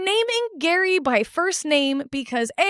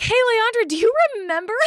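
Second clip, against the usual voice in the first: higher, gleeful teasing call; mocking glee; 6 semitones higher than usual. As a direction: taunt her before a room of people. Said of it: "she was like".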